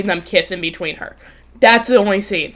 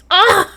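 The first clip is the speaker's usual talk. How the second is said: high, louder "ah"; swooning outburst; 11 semitones higher, 5 dB louder than usual. Real, not acted. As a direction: cry out as if overcome. Overcome by the hot guy.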